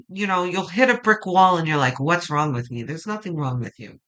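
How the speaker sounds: ordinary talk, nothing marked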